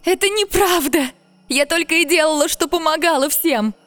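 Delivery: high, loud cry as if hurt; pained cry. Acted. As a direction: cry out as if in pain. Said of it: "вскрикнула будто от боли девушка".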